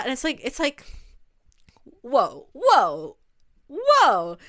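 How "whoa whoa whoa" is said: whoa, rising to a loud incredulous call; disbelieving whoa. Real, not acted. Reacting in disbelief to Lorelai's words, a loud whoa. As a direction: pull up short, louder each time. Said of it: "reacting in disbelief".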